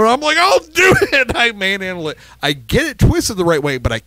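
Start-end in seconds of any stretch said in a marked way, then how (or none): 0.4-1.3 very high mock exasperation, laugh breaking in; retold stubborn insistence. Taking over the stuck bulb from Chris, stubborn and laughing.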